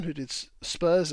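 normal talking voice

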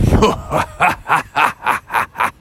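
breathy villain's cackle; a menacing evil laugh; sinister laugh. Acted. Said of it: "they heard a sinister laugh".

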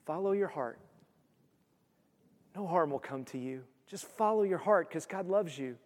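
soft, sugary false reassurance; mock soothing. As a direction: coo it like a false prophet lulling people. Mocking the false prophets' comfort.

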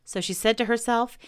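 plain reading voice

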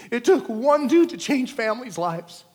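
ordinary talk

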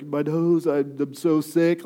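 hammed-up pitiful sniffly moan; calling in sick, hamming up the misery; fake sick voice. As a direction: oversell being ill. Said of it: "And then you're like".

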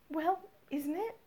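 a hint of a hopeful young woman; Jane's voice. A narrator's reading. Narrator voices Jane, pressing.

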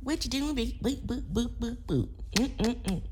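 put-on high, soft baby talk to a dog; silly clown. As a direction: coo at the puppy in a squeaky voice. Cooing to her dog Ethel.

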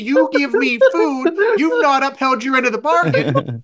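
high-pitched, worked-up customer rant; re-enacting a heated complaint; indignant protest. Customer arguing with corporate over his food, indignant.

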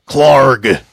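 Clarg! shouted out, loud and falling; dramatic proclamation. Pretend. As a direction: boom out the name Clarg!. Boss name proclaimed.

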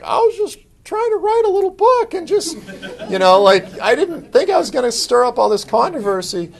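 high, whiny, laughing self-defence; imitating himself. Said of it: "And I was just like".

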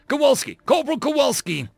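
high, commanding call; shouting after someone. Acted. Lieutenant calls after marine storming off, high shout. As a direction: call the corporal back sharply.

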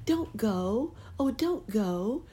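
high pleading fairy voice; tiny fairy voice. Acted. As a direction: beg him to stay, high and sweet. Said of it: "said the fairy".

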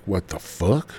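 hammed-up angry outburst; mock outrage. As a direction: flare up as the offended namesake. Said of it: "you heard your name and you're like".

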